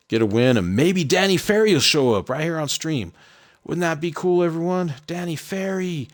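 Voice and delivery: high, sing-out mock cheer; sarcastic excitement. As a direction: practice a fake, over-hopeful celebration. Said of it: "that's why I'm being so sarcastic here".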